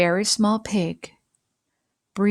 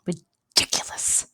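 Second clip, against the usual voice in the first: breathy whispered dismissal; whispered scoff; 4 semitones lower, 5 dB quieter than usual. Acted. Pete scoffing at Pickles' plan in a whisper. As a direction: whisper it like a muttered verdict. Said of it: "Pete said".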